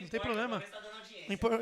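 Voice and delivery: ordinary delivery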